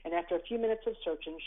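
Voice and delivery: ordinary delivery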